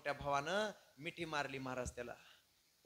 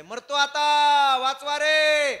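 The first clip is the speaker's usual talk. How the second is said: high, long distress yell; 10 semitones higher, 19 dB louder, much slower than usual. Acted. Man yelling to be saved from over there. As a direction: cry out as if dying, very loud. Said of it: "ते तिकडून ओरडायले".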